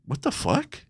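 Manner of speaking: incredulous under the breath, voice rising; hushed disbelief. Retold or imitated. Telling a friend his startled thought, soft and high. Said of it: "And I was like".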